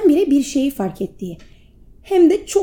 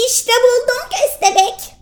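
much higher, thrilled squirrel; excited; 12 semitones higher than usual. Acted. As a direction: announce the find with joy. (heyecanlı sincap)

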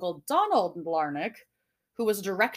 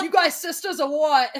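loud, raised mock Aussie accent; Australian accent; 7 semitones higher, 6 dB louder than usual. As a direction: imitate Australians while amused. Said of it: "The only Australians I've ever seen".

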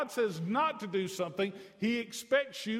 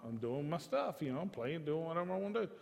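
lightly voiced casual son, softer; oblivious son; 4 semitones lower, 6 dB quieter than usual. Son answering his father's challenge, casually oblivious. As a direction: toss it off like an unbothered kid. Plays son's casual reply.

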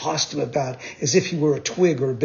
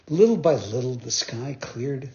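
ordinary delivery

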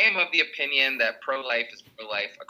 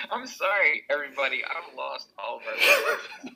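usual speaking voice

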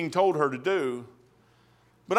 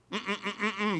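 humming laugh; 12 dB quieter than usual. Retold or imitated. King refuses, humming laugh.

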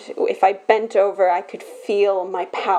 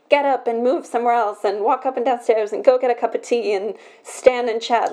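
ordinary delivery

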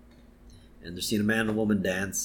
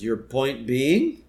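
deadpan retort; slower than usual. Retold or imitated. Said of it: "And Homer says".

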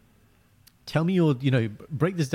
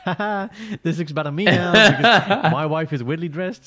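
high, loud cackling gloat; husband hamming up gloating over his wife's outfit; mock gloating laugh; 7 semitones higher, 6 dB louder than usual. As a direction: laugh through it like you've won. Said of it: "I'm like".

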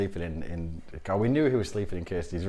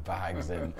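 normal talking voice

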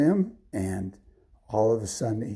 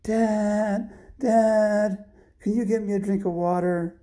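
put-on high, whiny-kid call for dad; little kid calling; 10 semitones higher, slower than usual. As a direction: play a small child calling out. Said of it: "he heard this kid saying".

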